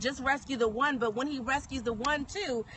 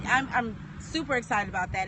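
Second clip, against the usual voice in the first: usual speaking voice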